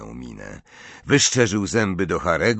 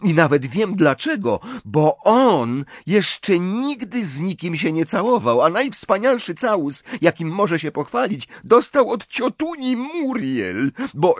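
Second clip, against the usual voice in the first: angry taunting; 10 semitones higher than usual. A narrator's reading. Ginny taunts Ron about never kissing, angry. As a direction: needle your brother, pitch climbing. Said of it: "patrząc ze złością na Harego".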